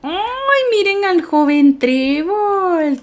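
high, stretched-out swooning coo; gushing admiration. Real, not acted. Streamer showing off a dressed-up pet, high swooning gush. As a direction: swoon over how dapper they look.

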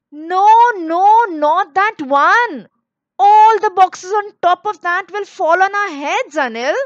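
alarmed warning. A narrator's reading. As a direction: call out a worried warning, high and loud. Mother warns son climbing for boxes.

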